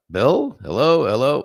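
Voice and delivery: hello into the void, rising; playful call for attention. Retold or imitated. Calling out to unanswering friend.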